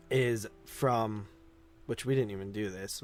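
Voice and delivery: normal talking voice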